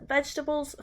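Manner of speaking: ordinary delivery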